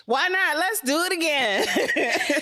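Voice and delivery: slow laugh-filled line, high. Real, not acted. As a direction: laugh through it slowly.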